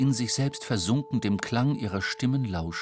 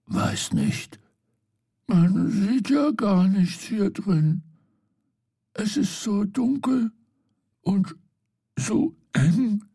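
scared hush; 9 semitones higher, 5 dB louder, slower than usual. Acted. Scared in the dark box.

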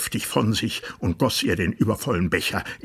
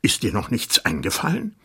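impatient; 2 semitones higher, faster than usual. Acted. King impatiently demands an idea from the dwarf. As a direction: press him, impatient. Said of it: "rief der König ungeduldig".

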